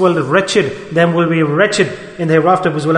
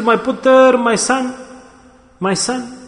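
high, tender mother cooing, fading away; doting mother; 7 semitones higher, slower than usual. Retold or imitated. Mother greeting her grown son as a little child, high tender coo. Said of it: "like she says".

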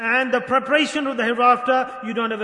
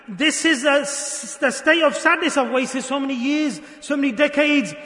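impassioned preaching; 2 semitones higher than usual. Real, not acted. Preacher warning friends about wasted years, impassioned preaching. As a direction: press each phrase home like a preacher.

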